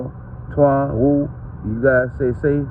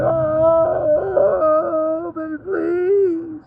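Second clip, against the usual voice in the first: very high, slow fake sobbing plea; mock crying plea; 12 semitones higher, much slower than usual. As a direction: whine it like a fake sob. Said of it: "That's what they are".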